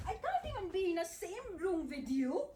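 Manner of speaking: normal talking voice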